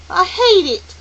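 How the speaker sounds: put-on high, loud child's outburst; fierce, high-pitched exclamation; passionate cry. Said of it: "Charles Wallace cried passionately".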